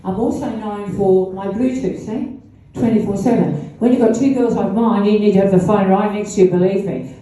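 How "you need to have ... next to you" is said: ordinary talk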